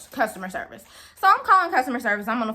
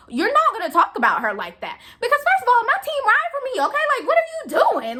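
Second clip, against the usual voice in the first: a hint of a feisty coworker, pitched higher; imitating sassy coworker; 10 semitones higher than usual. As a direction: stand up for your manager with attitude. Coworker defends her to customer.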